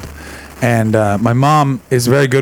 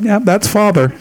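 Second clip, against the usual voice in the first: knowing reply; 7 semitones higher than usual. Retold or imitated. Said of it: "you both laughed and went".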